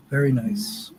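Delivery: hushed, breathy two-word aside; whispered aside. Real, not acted. Remembering his girlfriend to the stream, whispered. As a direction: let it slip out as a near-silent whisper.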